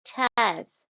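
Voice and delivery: tas set apart as the stressed beat; stressed syllable. Shown as a demonstration. Teacher isolating tas to show the stress. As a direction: give tas full weight by itself. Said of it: "So you will stress".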